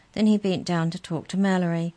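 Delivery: ordinary delivery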